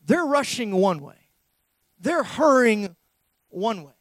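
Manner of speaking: loud, slow pulpit delivery; preaching at full force. Acted. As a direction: drive each phrase home from the pulpit.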